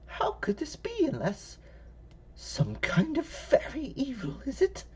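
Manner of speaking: put-on high hushed old woman whisper; whispering old lady. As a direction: whisper it like a fearful granny.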